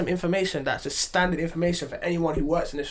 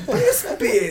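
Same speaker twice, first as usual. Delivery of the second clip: mock outrage; 13 semitones higher, 5 dB louder than usual. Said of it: "I was like".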